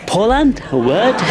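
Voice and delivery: high-pitched mock girl bafflement; puzzled Californian girl. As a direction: imitate a baffled girl, rising high on the question. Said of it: "And she was like".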